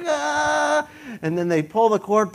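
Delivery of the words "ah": high, sustained "ah"; mock freefall scream. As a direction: hold a high cry like a face in the wind. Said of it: "Your face is like".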